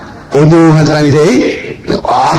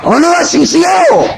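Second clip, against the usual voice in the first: much higher, slow shouted declaration; emphatic shout; 10 semitones higher than usual. Real, not acted. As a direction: proclaim it with full force. (높고 느리게 힘차게 외치기)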